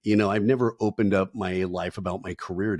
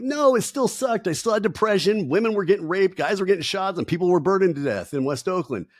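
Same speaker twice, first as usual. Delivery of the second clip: exasperated retort; 11 semitones higher, 4 dB louder than usual. Retold or imitated. Answering people who ask if things got better, a high exasperated rant. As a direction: fire it back like it's obvious.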